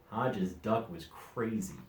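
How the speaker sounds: ordinary delivery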